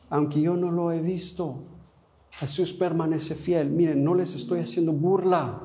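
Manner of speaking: ordinary delivery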